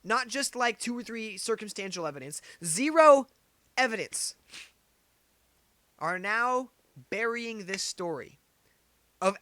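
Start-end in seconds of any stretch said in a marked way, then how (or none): 2.6-4.3 "zero" hit very loud and high; stress on zero. Real, not acted. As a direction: slam the word "zero". Ranting emphasis.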